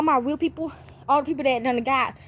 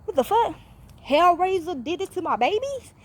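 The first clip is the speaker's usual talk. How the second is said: retold disbelief; 6 semitones higher than usual. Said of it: "So I'm thinking".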